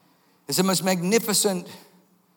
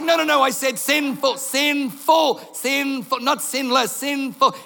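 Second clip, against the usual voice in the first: insistent protest; 8 semitones higher, slower than usual. Retold or imitated. Peter protests being called.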